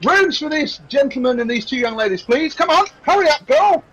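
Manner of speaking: high-pitched innkeeper ordering staff about; harried boss calling out orders; impatient innkeeper. Acted. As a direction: hurry the barmaid along across a busy bar. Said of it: "he said".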